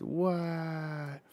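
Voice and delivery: high, drawn-out cartoon wail; hammed-up crying wail; mock crying. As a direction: bawl it out like a cartoon character. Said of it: "he's, like, crying".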